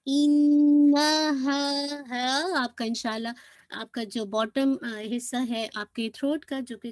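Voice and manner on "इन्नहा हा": इन्नहा drawn out on a long nasal n, then हा; held ghunna. Shown as a demonstration. Teacher models the two count hold, slow recitation. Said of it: "टू count hold करेंगे".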